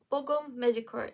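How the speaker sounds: "Bogum Magic Curl" run together quickly; quick, joined-up "Bogum Magic Curl"; said fast. Shown as a demonstration. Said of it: "If you say it fast enough".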